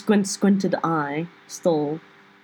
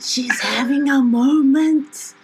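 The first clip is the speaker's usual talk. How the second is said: loud stage whisper; 6 semitones higher, 4 dB louder than usual. Acted. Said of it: "I'm gonna very loudly whisper".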